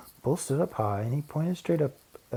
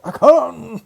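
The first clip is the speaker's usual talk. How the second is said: loud high growled retort; a loud mock growl; 15 dB louder than usual. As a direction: shout him down. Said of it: "growled the bear".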